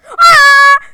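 very loud high shriek of fright; frightened scream. Real, not acted. Jump-scared by the monster, a piercing scream. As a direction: let out a sudden terrified scream. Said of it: "Biraz bağırdım arkadaşlar".

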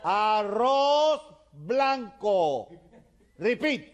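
very slow, high, loud dictation; shouting. Acted. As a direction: shout it syllable by syllable for the foreigner to copy. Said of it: "le sigue gritando".

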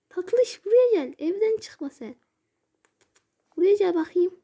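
high, loud sing-song coaxing; coaxing a pet. Pretend. Gamer coaxing a game cat to come, high and sweet. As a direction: call the kitty over sweetly.

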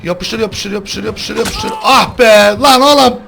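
high, loud frantic yells; frantic shouting. Real, not acted. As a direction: yell in panic, then plead. S0 escaping, then hooked by killer. (panik içinde yüksek sesle)

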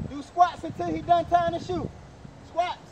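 slow, loud drill order; loud command. Real, not acted. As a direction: call it out to the whole gym. Coach orders squats loudly.

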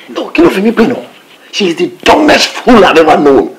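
loud, high, indignant rant; angry outburst. Acted. Refusing a partner in a deal, angry outburst. Irate argument over a deal.

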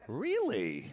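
high, drawn-out intrigued gasp of a word; hammed-up gossip's keen interest. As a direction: swoop up high with scandalized delight, then drop. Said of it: "their behavior slowly begins to rub off on you".

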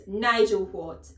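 very loud, drawn-out demand; barked challenge. A narrator's reading. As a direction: fire the question back sternly. Headmistress challenges boy's answer.

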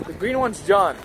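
ordinary talk

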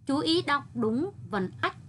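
ordinary delivery

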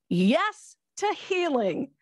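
joyful exclamation. Real, not acted. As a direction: stretch it out in joyful celebration. Celebrating her healing.

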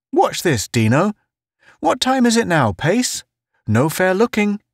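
high-pitched playful character voice; jovial teasing Town. Acted. Town testing Pace on the time, high playful voice. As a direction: set up the joke in a high, chummy voice.

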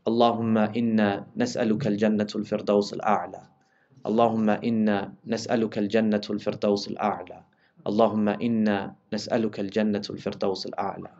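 soft, low supplication in Arabic; quiet prayer. Acted. Preacher closing the sermon with a soft prayer. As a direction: pray it softly to close the sermon.

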